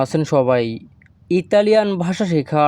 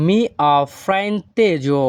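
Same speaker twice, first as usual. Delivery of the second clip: very slow, careful Italian sentence; drawn out for learners to copy; slow Italian model; much slower than usual. Shown as a demonstration. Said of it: "এই বাক্যের Italian ভাষা হবে".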